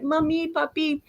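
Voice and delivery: put-on high-pitched frightened child mice; acted, urgent plea rising to a wail; calling for help. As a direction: cry out to parents while fleeing. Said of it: "they were calling to their parents".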